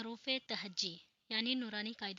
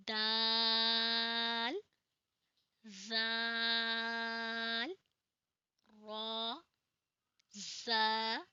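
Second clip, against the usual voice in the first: drawn-out Arabic letter names; slow Noorani Qaida reading; slow letter recitation; much slower than usual. Shown as a demonstration. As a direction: recite each letter slowly and clearly. Teacher reciting the Arabic alphabet.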